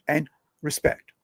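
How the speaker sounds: ordinary talk, nothing marked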